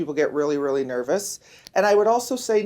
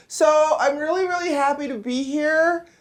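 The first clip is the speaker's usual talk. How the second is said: nervous speaker voice; 7 semitones higher than usual. Retold or imitated. Mimics nervous woman speaker.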